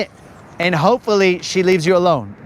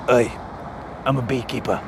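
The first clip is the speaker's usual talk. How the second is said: low, soft English tough guy; English accent; 7 semitones lower, 6 dB quieter, faster than usual. Acted. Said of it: "You're butchering the English accent".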